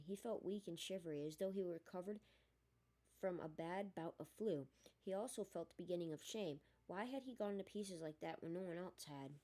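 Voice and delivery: ordinary delivery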